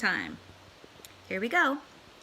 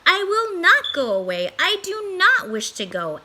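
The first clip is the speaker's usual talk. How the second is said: higher, louder playful cat; cheeky cat; 9 semitones higher, 7 dB louder than usual. Acted. Cat refusing to leave, cheeky and loud. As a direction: tease the fish merrily. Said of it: "said the Cat in the Hat".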